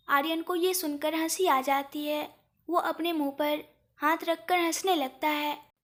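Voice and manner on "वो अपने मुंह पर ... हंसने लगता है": plain reading voice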